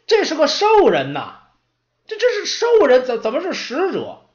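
high, loud flustered stutter; stammering apprentice. Acted. Apprentice baffled that the orc is an envoy, flustered stammer. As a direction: trip over the words in disbelief.

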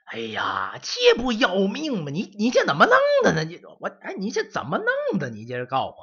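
put-on high, loud, sputtering old man; old servant panicking over the frozen library; flustered scolding. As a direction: splutter in alarm at the boy. Old man frets at mess.

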